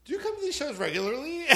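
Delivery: high-pitched imitated busybody query; pointed question. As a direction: lift the voice high into a leading question. Imitates confronting woman's leading question.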